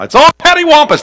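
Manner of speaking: a high, quick folksy Southern remark; Southern drawl. Shown as a demonstration. Preacher uses his favorite word in a folksy Southern voice. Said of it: "that's my word for everything".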